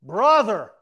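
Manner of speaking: booming shout across the lobby; a big, embarrassing public greeting; loud call. Retold or imitated. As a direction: call him out loudly from afar. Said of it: "He looks at me and he goes".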